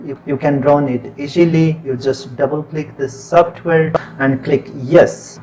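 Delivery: ordinary delivery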